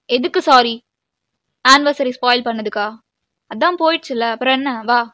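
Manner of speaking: loud, cutting rhetorical questions; angry scolding. Acted. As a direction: throw the apology back, loudly. Scolding partner over spoiled anniversary. (கோபமாக திட்டுவது)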